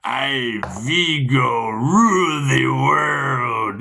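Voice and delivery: slow, loud booming villain decree; drawn-out loud villain voice; Vigo from Ghostbusters. Retold or imitated. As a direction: proclaim it like a movie tyrant. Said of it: "you remember Ghostbusters?".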